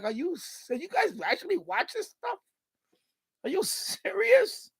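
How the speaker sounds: high, disbelieving question; incredulous. Retold or imitated. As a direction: ask like you can't believe it. His own incredulous reply.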